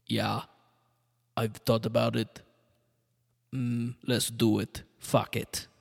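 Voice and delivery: put-on lightly done Austrian accent, slowed down; a casual, shrugging Arnold in an Austrian accent. Arnold agreeing to try acting, in a mild Austrian accent. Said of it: "The accent got worse".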